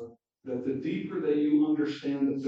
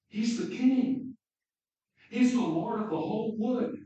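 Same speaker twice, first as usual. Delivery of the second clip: higher, louder, awed announcement; proud proclamation; 9 semitones higher, 4 dB louder than usual. Acted. Mr Beaver telling the children about Aslan, higher and louder in awe.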